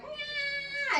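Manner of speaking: high, squeaky fake wail; mock crying wail. Old lady lying in traffic wails at the narrator. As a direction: whine it out like a fallen old lady. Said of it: "crying like".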